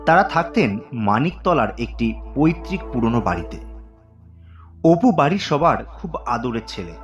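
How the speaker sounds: ordinary delivery, nothing marked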